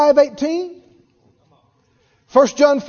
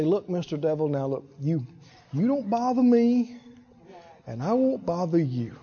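mock deal with the devil; 6 semitones lower, 9 dB quieter than usual. Believer bargaining with the devil, meek lowered wheedle. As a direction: lower and much softer, wheedling. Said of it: "You're gonna say".

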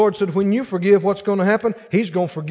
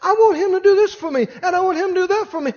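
much higher imitated woman's voice; insistent lady; 9 semitones higher, faster than usual. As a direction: imitate a wife pressing her demand. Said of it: "I had a lady one time".

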